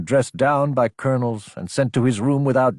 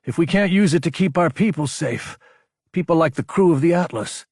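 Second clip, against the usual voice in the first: pitched-up, pressing protest; troubled outburst; 8 semitones higher, 3 dB louder than usual. A narrator's reading. Lieutenant questioning his superior, troubled outburst. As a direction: push the doubt out, high and urgent. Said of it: "giving voice to the thoughts plaguing him".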